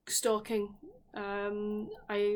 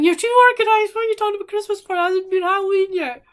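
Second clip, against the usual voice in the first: high, fast, very loud mock yelling; imitated shouting; 11 semitones higher, 14 dB louder, faster than usual. Viewers scolding the host for early Christmas talk, mock shouting. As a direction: shout it out like viewers screaming in protest. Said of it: "I can hear you shouting at me".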